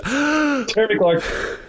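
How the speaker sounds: high, drawn-out gasp of recognition; hammed-up amazed discovery; mock astonishment. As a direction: playfully gasp the name, stretched out.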